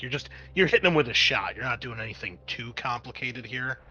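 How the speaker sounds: normal talking voice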